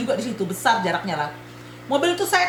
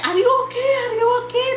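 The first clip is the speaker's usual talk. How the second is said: high-pitched urgent checking on a victim; worried asking; 11 semitones higher than usual. Retold or imitated. Other driver runs over to check on her, worried and high. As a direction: imitate a worried stranger, pitched way up. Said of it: "Dia nanya".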